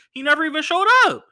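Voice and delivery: loud, high indignant exclamation; indignant punchline. Real, not acted. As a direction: tells viewers Reggie never showed, indignant. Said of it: "punchline to viewers".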